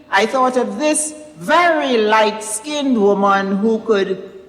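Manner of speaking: ordinary delivery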